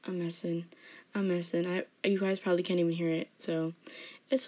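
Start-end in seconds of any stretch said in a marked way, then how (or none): none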